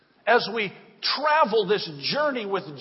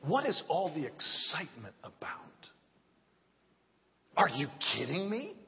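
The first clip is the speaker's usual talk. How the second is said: hushed preaching; 4 semitones lower, 8 dB quieter than usual. Real, not acted. Preacher drops voice low.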